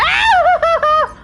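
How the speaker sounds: sudden high scream; startled shriek. Real, not acted. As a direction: shriek in fright. Shrieks at a jump scare.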